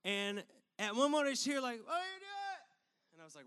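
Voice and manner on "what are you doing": imitating a faraway shout, high and muffled; slow, high, softer call from far off; drawn-out mock yell heard at a distance; distant yell. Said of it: "I remember hearing someone yell".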